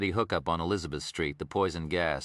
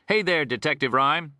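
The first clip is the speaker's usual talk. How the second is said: higher, louder Texan greeting; Texas warden; 8 semitones higher, 5 dB louder than usual. Acted. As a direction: hail him in a folksy Texas accent.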